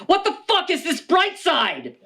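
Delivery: high, laughing disbelief; incredulous heckler. Acted. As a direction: blurt it like an outraged audience member. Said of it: "others may ask".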